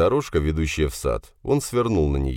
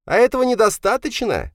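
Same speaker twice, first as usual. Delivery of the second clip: very high, loud protest; angry retort; 5 dB louder than usual. Acted. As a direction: fling it back at father, pitched way up. Son argues back in quarrel. (злой ответ высоким голосом)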